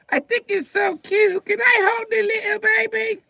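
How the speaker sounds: put-on very high, slow, loud child's voice; high little girl. A little girl begging to hold a baby, very high voice. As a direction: coo over and over like a stuck toy. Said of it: "their voice is so high-pitched".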